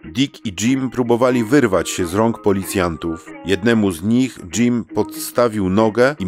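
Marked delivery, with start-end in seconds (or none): none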